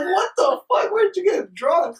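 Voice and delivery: high-pitched retold disbelief; slow, high, astonished questioning; incredulous. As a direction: demand an explanation, voice shooting up. Retells disbelief at drunk friend.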